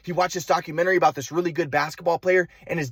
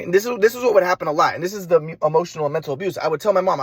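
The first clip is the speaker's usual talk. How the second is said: plain reading voice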